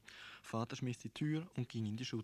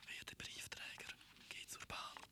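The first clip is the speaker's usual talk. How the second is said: faint voiceless whisper from far off; whispering door; 12 dB quieter than usual. A narrator's reading. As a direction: breathe it through the door like a secret. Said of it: "Die Tür hat mir's ins Ohr geflüstert".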